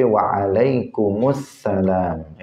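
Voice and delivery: very slow "Waalaikumsalam" with every syllable drawn out; slow teacher's "Waalaikumsalam"; correct reply. Shown as a demonstration. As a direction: stretch "Waalaikumsalam" out carefully.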